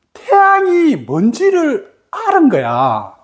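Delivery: ordinary talk, nothing marked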